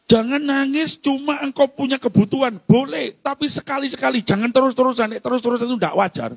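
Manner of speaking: high, urgent exhortation; forceful preaching. Real, not acted. Preacher urging the congregation to turn to God, forceful preaching. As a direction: press it home from the pulpit.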